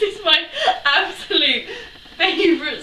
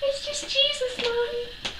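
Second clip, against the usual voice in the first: put-on high, soft innocent little boy; small child; 10 semitones higher, 7 dB quieter, slower than usual. Little brother explaining to mum, sweet child voice. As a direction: say it sweetly like a toddler.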